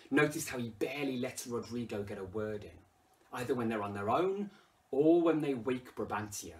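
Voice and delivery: ordinary talk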